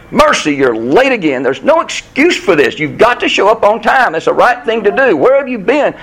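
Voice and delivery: a stern scolding that climbs in pitch; mild scolding rant at the late friend; railing. Acted. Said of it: "Justice at first starts to rail at him".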